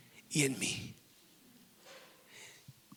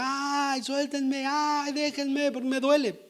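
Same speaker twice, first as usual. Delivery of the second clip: higher, strained plea to be let go; pained cry; 6 semitones higher, slower than usual. Retold or imitated. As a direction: cry out in pain like the bent woman. (súplica adolorida y aguda)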